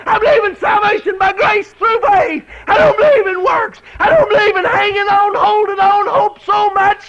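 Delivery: shouted, high preaching cry; preaching at full force. Real, not acted. Preacher shouting his faith to the church, full force. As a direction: whoop the faith out to the congregation.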